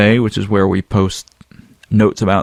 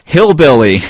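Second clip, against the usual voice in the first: louder, higher called-out yell; 5 semitones higher, 6 dB louder than usual. Retold or imitated. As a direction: shout it from the audience. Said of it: "someone in the audience yelled out".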